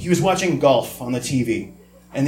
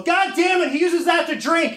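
high-pitched mock mom squawk; shrill-free, much higher exasperated mom voice; mom scolding. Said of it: "And my mom would go".

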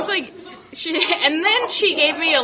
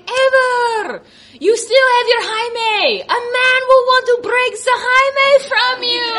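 high, louder mock doctor; imitating the doctor, pitched up; doctor's voice; 7 semitones higher, 3 dB louder than usual. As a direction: mimic the doctor's accent, raised. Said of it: "She was like".

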